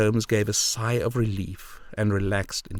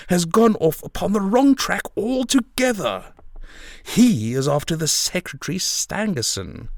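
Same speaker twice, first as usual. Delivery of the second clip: higher, emphatic crowing; amused cry; 6 semitones higher than usual. A narrator's reading. Detective mocking his rival to Holmes, highly amused. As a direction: relish the rival's mistake aloud. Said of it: "he cried".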